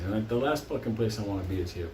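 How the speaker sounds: ordinary talk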